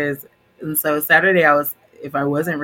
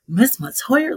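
surprised; 9 semitones higher, slower than usual. Retold or imitated. Classmate recognizes teacher at prom.

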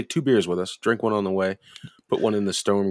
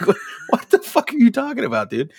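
loud, high-pitched disbelief through a laugh; retold baffled outburst, pitched way up; incredulous; 6 dB louder than usual. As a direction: squawk it in mock bewilderment. Retold incredulous reply to stoned friend.

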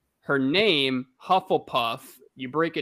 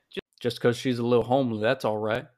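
Phil Robertson impression; 4 semitones lower, faster than usual. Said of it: "Phil Robertson once said".

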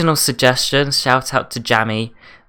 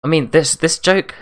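normal talking voice

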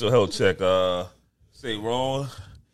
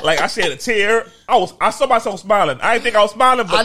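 excited; 8 semitones higher, 7 dB louder than usual. Real, not acted. Excited recounting, rising.

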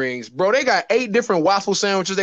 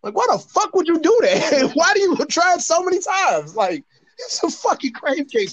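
much higher, pitch jumping up in disbelief; incredulous; 10 semitones higher than usual. Retold or imitated. Mocking his friend's burger trick, high and incredulous. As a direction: ask it like you can't believe anyone would.

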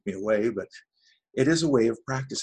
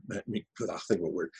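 usual speaking voice

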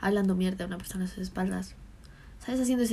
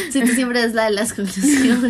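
laughing; 2 semitones higher, 8 dB louder than usual. Real, not acted. Laughing reply.

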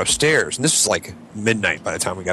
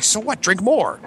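much higher, mock-scoffing voice; incredulous Rahm; 11 semitones higher, slower than usual. Friend imagining the host brushing off drunkenness, high mock scoff. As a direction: squeak it out as if it's obvious. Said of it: "he's like".

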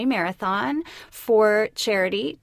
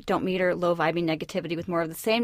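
ordinary talk, nothing marked

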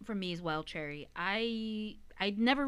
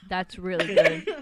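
laughing; 12 dB louder, slower than usual. Real, not acted. Laughing while tasting sour candy.

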